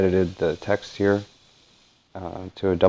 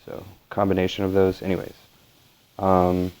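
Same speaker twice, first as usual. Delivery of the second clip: ordinary talk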